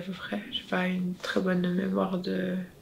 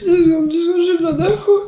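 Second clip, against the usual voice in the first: distressed, breathless crying; 9 semitones higher, 11 dB louder, faster than usual. Real, not acted. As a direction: gasp it out through tears. Struggling after surgery, crying heard.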